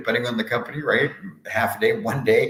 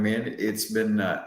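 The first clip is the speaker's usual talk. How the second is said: ordinary delivery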